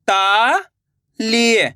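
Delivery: very slow, each syllable drawn out; slow syllable reading. Shown as a demonstration. Teacher showing pupils how to sound out ताली slowly. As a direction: say it apart, slowly. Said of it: "तो मिलके बन जाएगा".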